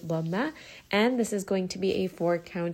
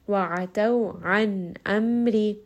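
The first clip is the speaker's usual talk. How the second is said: An amri very slow, pausing and resting on the nun and mim; resting on letters; 4 dB louder, much slower than usual. Shown as a demonstration. Teacher demonstrating tawassut, very slow with rests. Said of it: "make sure that you are resting and pausing".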